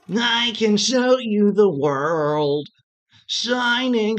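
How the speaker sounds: low slow crooning tone; lightly voiced suitor. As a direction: serenade her, slowly. Sings Aladdin line as Taki.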